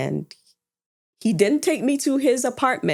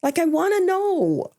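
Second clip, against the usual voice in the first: exasperated plea; 11 semitones higher than usual. Retold or imitated. Retelling her frustration at father.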